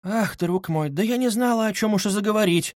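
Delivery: high-pitched flustered mother, lightly voiced; blurted out. A narrator's reading. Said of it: "вырвалось у Пульхерии Александровны".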